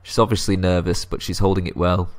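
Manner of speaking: ordinary talk, nothing marked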